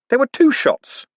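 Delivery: put-on high, outraged young man; blurting a defence; indignant protest. Moist protests.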